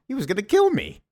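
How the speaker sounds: mock outcry. Nephew accusing his uncle to his aunt, mock indignant cry.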